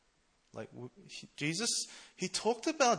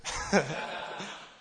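laugh; 4 semitones higher than usual. Real, not acted. Joke about being treasurer.

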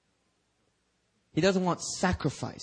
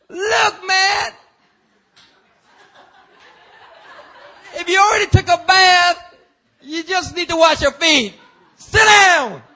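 high shouting, half laughing; exasperated Jesus; 14 dB louder than usual. Retold or imitated. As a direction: yell at him to sit down.

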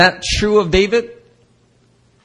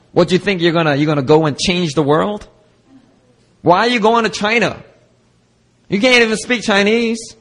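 a hammed-up scoffing critic; mocking doubter; 5 semitones higher than usual. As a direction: sneer down at her calling. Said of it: "you may have heard something similar to you being spoken".

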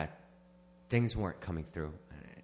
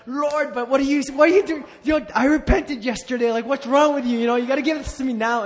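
much higher, much louder exasperated demand; mock exasperated prayer; 11 dB louder than usual. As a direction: shout at God, half in fun. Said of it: "I'd be like".

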